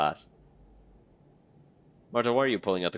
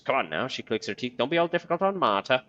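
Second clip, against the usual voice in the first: Marta's voice; 5 semitones higher than usual. Acted. Reading Marta's line to herself in a high crone voice. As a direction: croon it like an odd old woman.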